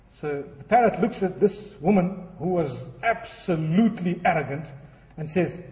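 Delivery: normal talking voice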